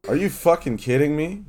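high, loud, incredulous yell; incredulous outburst. Real, not acted. Host ranting to his friend about being rickrolled, loud disbelief. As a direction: blurt it out, unable to believe it. Indignant rant about betrayal.

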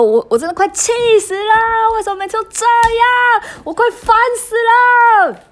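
high, loud furious yell muffled by a pillow; retold shout into pillow. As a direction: scream your frustration into the pillow. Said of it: "大声的". (对着枕头大声怒吼)

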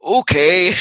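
put-on strained throaty old man. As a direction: push it from the back of the throat. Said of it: "talking from the back of your throat".

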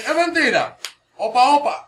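imitating street talk. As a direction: throw it out like a chopo.

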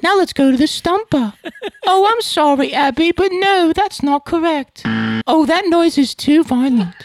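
put-on very high, drawn-out British child voice; prim little put-on child hosting a show; piping waif. Son hosting the whole show as Papa's child, piping waif voice. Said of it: "one hour of like".

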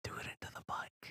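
unvoiced whisper; hushed egging on. Real, not acted. Whispered urging.

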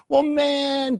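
high, drawn-out mock protest; imagined objector. Imagined listener to Pastor Van Amen, high mock protest.

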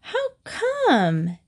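sneering, slow on ratbag, then shooting up high; scornful girl. Acted. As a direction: spit out ratbag with disdain. Evelyn sneers at Cornelia.

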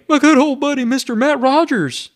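high booming emcee intro of his buddy; Kent Brown impersonation. Retold or imitated. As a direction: belt out the name like Kent Brown. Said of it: "That's my Kent Brown impersonation".

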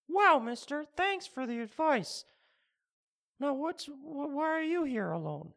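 put-on high, boyish "Wow, mister"; naive kid voice. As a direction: play a wide-eyed kid thanking a stranger. Naive young self voiced high.

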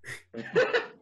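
high laugh. Real, not acted. Wig joke cracks him up.

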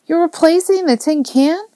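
put-on high-pitched child's dismayed question; anguished boy. A narrator's reading. Boy learns the old car is going, dismayed. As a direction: cry out in a small boy's disbelief. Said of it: "Joe's face contorted with anguish".

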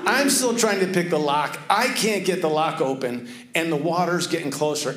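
ordinary delivery

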